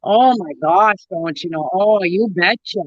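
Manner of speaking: thick northern Minnesota lilt on long vowels; heavy Minnesota accent. Shown as a demonstration. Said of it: "mine gets way worse".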